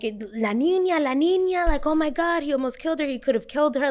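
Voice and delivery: high, hammed-up parental alarm; alarmed parents. Parents seeing the girl almost killed, high imitated alarm.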